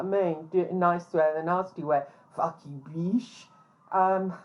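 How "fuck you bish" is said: lightly imitated posh accent, quietly menacing; a soft, mock-posh threat. Suited upper middle class man threatening her like a gangster, posh voice. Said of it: "all said in a posh voice".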